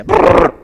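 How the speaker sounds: high, loud mock rumble; playful rumble. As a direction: make the belly-kick noise. Imitates baby kicking.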